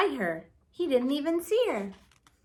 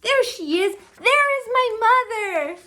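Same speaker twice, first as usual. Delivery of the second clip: much higher, louder, joyful; excited shout; 10 semitones higher, 9 dB louder than usual. Acted. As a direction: sure he found his mother. Bird spots the big thing.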